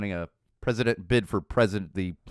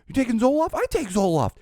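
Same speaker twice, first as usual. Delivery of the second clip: excited; 14 semitones higher, 3 dB louder than usual. Retold or imitated. Another guy excitedly joins.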